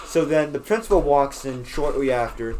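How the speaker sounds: usual speaking voice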